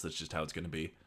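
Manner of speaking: usual speaking voice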